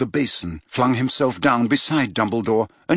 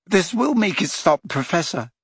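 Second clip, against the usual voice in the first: voice cracking; 6 semitones higher than usual. A narrator's reading. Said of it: "his voice cracking".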